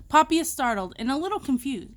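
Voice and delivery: ordinary delivery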